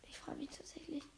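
soft breathy whisper to yourself. Real, not acted. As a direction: whisper it as a private aside. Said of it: "leise für sich". (geflüstert)

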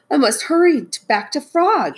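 high, hurried-sounding alarm; urgent cry. Acted. Toad rushing melting cones back to Frog, urgent cry. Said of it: "he cried".

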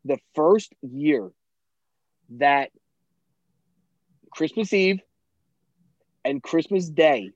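slow, each phrase set apart by pauses; slow emphatic boast. Real, not acted. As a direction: savour every word of the brag. Boasting about flawless Christmas cooking.

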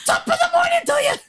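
high, shouted mock-British accent; bad British accent. Co-host mocking people who fake British, exaggerated accent. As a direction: ham up a terrible British impression. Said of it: "Everyone's like".

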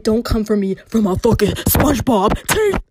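loud, worked-up warning; indignant outburst. Acted. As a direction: raise your voice in mock outrage. Warns off her mocker, worked up.

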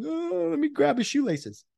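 high, slow mocking whine; mocking weak tackler. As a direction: sneer at the limp tackle attempt. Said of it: "you're like".

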